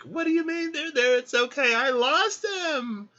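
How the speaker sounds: loud, very high hammed-up wail; mock distraught. Woman at the coffin to her comforter, mock distraught. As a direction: wail back in pretend outrage and grief.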